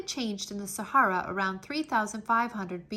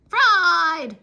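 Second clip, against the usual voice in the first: high, very loud shout; plantains shout; 8 semitones higher, 13 dB louder than usual. A narrator's reading. Plantains shouting their choice at Anansi, loud and high. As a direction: bellow it with joy. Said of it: "the plantains shouted".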